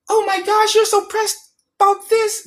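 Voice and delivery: squeaky falsetto mock dismay; exaggerated high-pitched taunt; mocking imitation. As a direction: mimic a dismissive critic in a very high voice. Mimicking critics' dismissive complaint.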